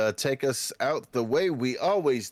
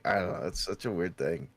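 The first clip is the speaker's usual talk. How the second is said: usual speaking voice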